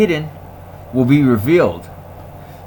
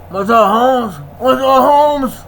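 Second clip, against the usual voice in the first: mock greeting; 10 semitones higher, 3 dB louder than usual. Mocking voice of the deleters.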